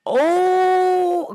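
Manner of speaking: high, loud drawn-out oh; sudden revelation cry; realization. Real, not acted. Realizing the people were agitators, a loud astonished oh.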